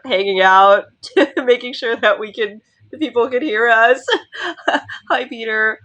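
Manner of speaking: real giggles breaking into the words; giggling. Real, not acted. Co-host thanking the sound helpers, giggling.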